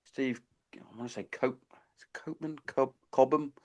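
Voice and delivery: whispered, half to himself while searching for a name. Real, not acted. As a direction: whisper it as you dig for the name. Straining to recall a name.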